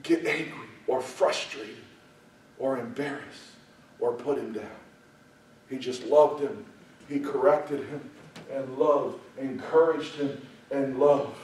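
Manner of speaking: soft, low preaching voice; tender and hushed. Real, not acted. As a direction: bring it right down, tenderly. Preacher drops to tender close.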